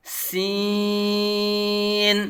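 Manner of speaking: سین stretched very slowly with a sharp whistling s. Shown as a demonstration. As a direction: let the s of سین whistle, then sustain the vowel. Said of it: "سیٹی کی طرح تیز آواز نکلے گی".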